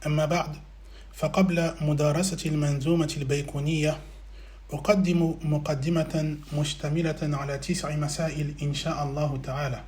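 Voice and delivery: low, soft, rapid monotone recitation; formal Arabic invocation. Acted. Lecturer opens lesson with praise and blessings, formal recitation. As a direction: recite the opening formula evenly and quickly, voice kept down.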